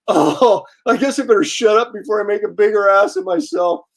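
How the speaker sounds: lightly voiced drunk backing down; drunk fan. Drunk fan realizing his blunder to the artist, lightly imitated. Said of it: "and the guy's drunk".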